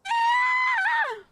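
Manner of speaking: piercing high cartoon shriek; playful high-pitched squeal; shrill squeal. Shrill shout.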